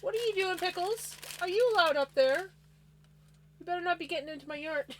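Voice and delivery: high scolding call to the pet; sharp playground warning. Real, not acted. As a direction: call him down, half-laughing.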